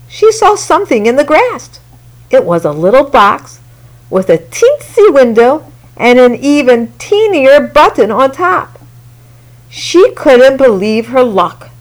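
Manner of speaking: ordinary delivery, nothing marked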